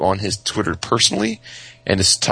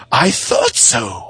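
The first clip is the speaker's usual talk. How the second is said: slow, knowing toy voice; smug toy voice; 3 semitones higher, 3 dB louder, much slower than usual. Retold or imitated. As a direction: drag it out like a smug gadget. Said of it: "And it's like".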